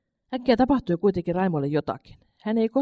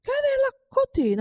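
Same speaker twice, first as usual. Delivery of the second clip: "Kävellä kotiin?" asked much higher and slowly, in disbelief; astonished. A narrator's reading. Mother echoes her son's words, in astonishment. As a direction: echo "Kävellä kotiin?" in high, slow astonishment. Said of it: "suuresti hämmästyneenä ihmetteli".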